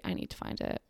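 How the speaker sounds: whispered under her breath; unvoiced whisper to herself. Real, not acted. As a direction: whisper it to yourself while searching. Muttering to herself, searching.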